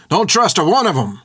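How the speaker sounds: high, agitated rabble-rousing shout; riled-up rider's shouted warning; shouting at crowd. A narrator's reading. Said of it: "began shouting at the crowd".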